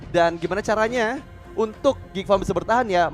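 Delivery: ordinary talk, nothing marked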